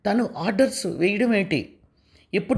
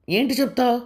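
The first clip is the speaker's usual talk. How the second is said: higher, sharp angry question; 8 semitones higher than usual. Acted. Wife answers her husband's request angrily, higher. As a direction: snap back at him. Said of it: "అంది కోపంగా".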